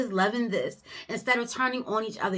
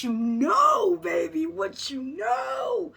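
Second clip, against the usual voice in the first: higher, building preacher shout; rising shout; 7 semitones higher, slower than usual. Real, not acted. Reader praising the couple's faith, rising shout.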